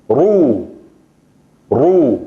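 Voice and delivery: slow drawn-out ru, teacher demo; Arabic ru. Shown as a demonstration. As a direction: say Ru with a rounded u, drawn out. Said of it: "son así". (pronunciación árabe de Ru, lenta y clara)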